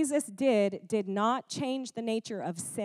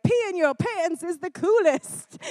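high, louder goofy hype; Adam Sandler hyping; 8 semitones higher, 7 dB louder than usual. Retold or imitated. Adam Sandler to the school bus kids, high goofy hype. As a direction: sell it like a ridiculous cheerleader. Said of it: "He's like".

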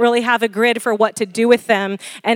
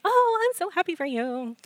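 higher, breathy-light fake gush; mock gushing; 6 semitones higher, 9 dB quieter than usual. Imitating a shallow friend's fake congratulations. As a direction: act happy without meaning it.

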